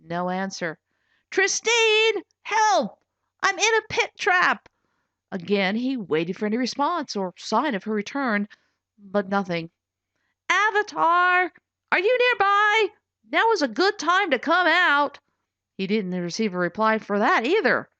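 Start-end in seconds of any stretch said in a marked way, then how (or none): 1.3-4.7 higher and louder cry for help; calling for help. Acted. Trapped in pit, calling for help. 10.5-15.2 higher, louder call to Avatar. Acted. Trapped, calling for Avatar.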